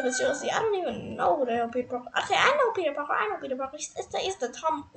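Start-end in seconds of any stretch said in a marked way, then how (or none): none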